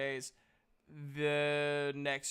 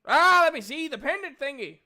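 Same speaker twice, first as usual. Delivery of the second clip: loud, high-pitched, excitable character voice; booming game character; 12 semitones higher, 12 dB louder than usual. Acted. Game master's character asks to see the pendant, booming. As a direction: call it out big and eager.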